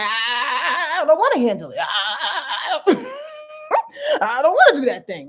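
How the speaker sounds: high, loud, mock crying; hammed-up toddler whining; mock tantrum, high whiny sobs. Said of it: "Aquarius energy is like".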